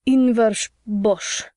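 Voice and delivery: put-on slow slurred drunk, Inversh mushed; drunken slurring. A narrator's reading. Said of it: "He said something like".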